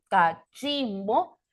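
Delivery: "cachimbo" split up, with the stress on the middle syllable "chim". Shown as a demonstration. Teacher showing the tonic syllable, "cachimbo" stressed on "chim". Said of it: "aquella que lleva la mayor fuerza de voz".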